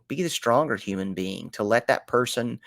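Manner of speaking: normal talking voice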